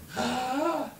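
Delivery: sharp audible intake of breath; demonstrated gasp. Shown as a demonstration. Showing how breathing works.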